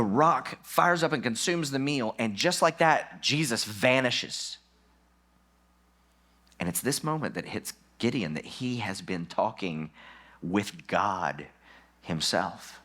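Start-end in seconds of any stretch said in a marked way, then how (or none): none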